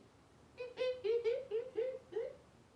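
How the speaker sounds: high, loud monkey cackle; monkey noise. Shown as a demonstration. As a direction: screech like a playful monkey. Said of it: "you can make the sound of a monkey".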